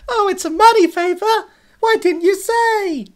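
high, loud mock-jolly squawk; annoying puppet gangster. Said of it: "little annoying puppet".

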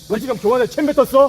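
angry drill instructor. Real, not acted. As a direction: confront the trainee at full voice. Instructor confronts a trainee.

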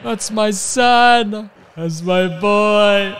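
slow, high, loud, half-sung lament; mock anguished wail. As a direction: wail for the lost pet Corey. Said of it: "And I'm gonna be like".

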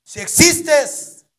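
loud, slow, high summoning; shouting. Acted. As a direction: call the devil out. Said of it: "le empezó a gritar". (invocación a gritos)

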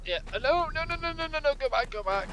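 frantic high-pitched pleading; panicking, voice shooting up; panicked. Real, not acted. Panicking as enemy approaches.

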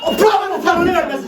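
sudden high cheer; excited shout. Real, not acted. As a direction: yell it out in excitement. Fans react to play on screen. (heyecanlı bağırış)

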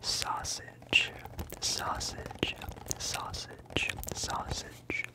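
the word Sausage whispered slowly, fading each time; whispered trigger word. Shown as a demonstration. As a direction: let each Sausage trail off into the mic. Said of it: "Can I say sausage?".

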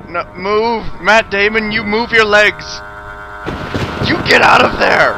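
loud frightened shouting; panicked yelling. Real, not acted. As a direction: yell in real panic.

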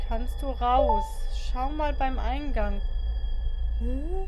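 tense loud. Real, not acted. Tense horror game moments.

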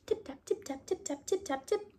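high, soft squeaky mouse voice; little tip taps squeaked high and soft; tiny mouse tapping. A narrator's reading. Narrator voicing mice tapping under the teacups, high and soft.

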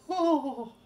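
loud, high "oh" of a fainting fit; swooning cry. Shown as a demonstration. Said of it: "Vapors are when you're like".

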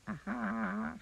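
high goblin aha and giggle; goblin glee. A narrator's reading. Goblin has a trick for Mister Uppity, high goblin giggle.

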